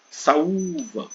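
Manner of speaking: saúva as a call, leaning on the middle Ú; stressed syllable stretched. Shown as a demonstration. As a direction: drag out the Ú in saúva. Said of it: "arrastar um pouco mais".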